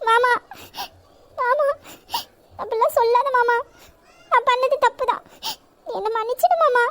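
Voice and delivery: tearful pleading apology; sobbing sorry to her uncle-lover; crying plea. Acted. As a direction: beg him through tears. Girl begs forgiveness crying.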